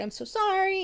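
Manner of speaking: high-pitched service-counter apology; put-on customer service voice. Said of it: "doing the whole customer service thing".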